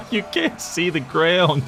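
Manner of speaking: cracking up at the sight; laughing. Real, not acted. Laughing at the huge army.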